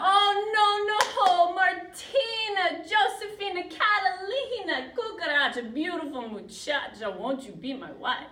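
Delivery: over-the-top lovesick plea, each name stretched; pleading suitor. Acted. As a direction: beg for her hand at full theatrical volume. Lizard begs Martina to marry him.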